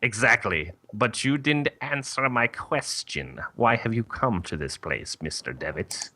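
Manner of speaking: probing mother superior; nun voice. Acted. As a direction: question the visitor like a formal, stern nun. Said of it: "when I thought nun voice".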